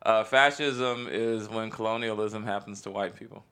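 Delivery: normal talking voice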